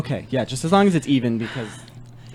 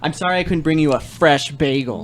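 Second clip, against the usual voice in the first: exasperated outburst, pitched up then settling; super mad; 2 semitones higher than usual. Acted. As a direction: blow up in mock fury over a complaint. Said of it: "gonna make Kevin super mad".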